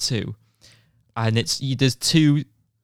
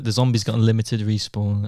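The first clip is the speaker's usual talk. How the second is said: ordinary talk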